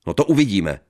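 loud threatening warning; loud threat. Acted. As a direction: warn her sharply. Husband threatens. (hlasitá výhrůžka)

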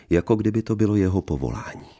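ordinary delivery, nothing marked

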